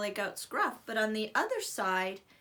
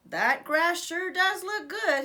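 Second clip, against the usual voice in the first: slow goat brother voice; 7 semitones higher, 5 dB louder, slower than usual. Acted.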